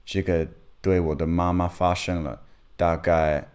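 Mandarin with a foreign accent; non-native speaker talking Chinese; foreigner speaking mandarin. Real, not acted. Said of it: "我的中文不太好".

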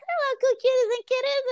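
high, sugary greeting voice; a lightly voiced sweet hostess; imitating Carole. Friend mimics the big cat lady's greeting, high and sugary. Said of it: "she's just like".

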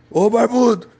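high, loud call across a near-empty bus; shouting across the bus. Retold or imitated. Man at the back of the bus calling the narrator, high loud yell. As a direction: yell at the bearded guy from the back. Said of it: "ele gritava assim".

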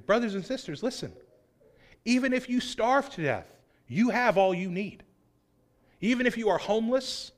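ordinary talk, nothing marked

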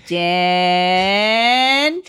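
long drawn-out throaty call of the name; imitating mom, throaty. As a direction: stretch it out like mom calling. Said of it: "uh, throaty".

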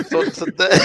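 restarting through real laughter; high, giggly false starts; too tickled to get going; laughing while talking. Real, not acted. Laughing at own story.

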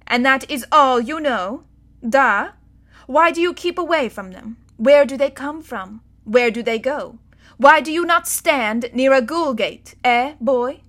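a strict tutor firing questions; stern governess. A narrator's reading. Miss Lupissieu grilling Bod.

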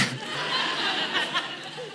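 a real, breathy burst of laughter; real laughter without a word. Real, not acted. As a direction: laugh out at the surprise. Laughing at the punchline.

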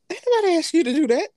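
emphatic dismissive rebuke; loud retort. Real, not acted. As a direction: snap back at the complainer. Retorting to mimicked women.

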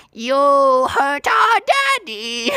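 high, very slow creepy little voice; high drawn-out cartoon creature voice; little creature twin. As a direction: stretch it out high as a tiny creature. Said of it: "and he's like".

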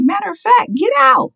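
high shouted command. Acted. Preacher commanding the congregation to get out of the way, shouting. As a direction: yell it out at the peak.

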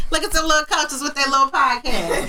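laughing mockery. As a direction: crack up while mocking them. Repeats mocking line laughing.

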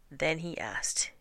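usual speaking voice

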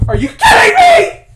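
very high, full-volume outraged shout; acted meltdown, shrieking at terrible news; losing his mind. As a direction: scream it in hammed-up disbelief. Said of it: "he literally lost his mind".

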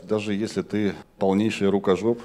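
normal talking voice

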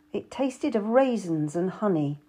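usual speaking voice

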